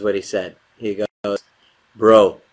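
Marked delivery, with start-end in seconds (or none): 1.9-2.4 loud, drawn-out bro; a big loud bro; emphatic bro. Retold or imitated. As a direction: say it loud and heavy. Friend's one-word reply.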